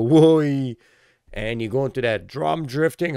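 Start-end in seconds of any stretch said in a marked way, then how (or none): 0.0-0.7 mock whoa. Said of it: "the back go".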